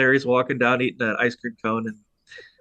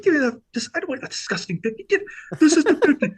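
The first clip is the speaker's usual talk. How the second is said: higher, laughing disgusted rant; mock disgust; 3 dB louder than usual. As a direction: push the money away in exaggerated disgust. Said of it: "He's like".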